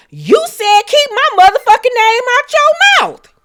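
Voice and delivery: high, loud imitation of her yelling; retold yell. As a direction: belt it out like Kimmy screaming across the room. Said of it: "yells and said".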